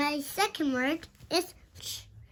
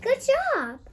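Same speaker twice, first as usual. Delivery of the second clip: praise; 8 semitones higher than usual. Acted. Child praising parent teacher-style.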